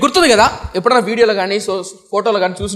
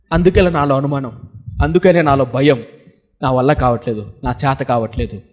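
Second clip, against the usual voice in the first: low, subdued helplessness; mild imitation of tearful despair, pitched low; retold tearful confession; 6 semitones lower than usual. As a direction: voice a crying girl giving up, lightly acted. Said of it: "ఇప్పుడు నువ్వు ఏడుస్తున్నావే ఏడుపు".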